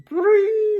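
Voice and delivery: high, loud mock ring of a phone; a sudden shrill-free but very high, loud imitated ring. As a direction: sing out the ring like a telephone. Imitates phone ringing in story.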